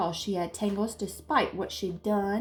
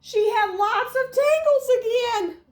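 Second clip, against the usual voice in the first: much higher and louder; screaming; 8 dB louder than usual. A narrator's reading. Narrator builds up Jaya's scream at the tangles. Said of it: "Jaya screamed".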